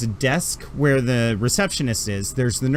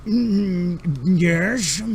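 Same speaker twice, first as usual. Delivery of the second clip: much higher, fussy put-upon doctor voice; grumpy alien doctor; 6 semitones higher than usual. Acted. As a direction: huff like an overworked old doctor. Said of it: "he's like".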